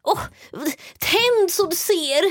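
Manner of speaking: put-on high, slow, patronising old lady; mocking an old person's obvious advice. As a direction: drawl it like a condescending elderly client. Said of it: "de bara".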